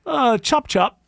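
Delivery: put-on a reluctant, laughing try at a British accent; half-hearted British accent. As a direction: mumble a British accent you can't do. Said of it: "The British".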